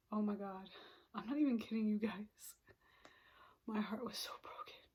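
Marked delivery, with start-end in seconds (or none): 3.7-4.8 a quiet, wounded confession; heartbroken whisper. Real, not acted. Telling viewers her heartbreak, in a soft whisper. Recalling heartbreak over sister.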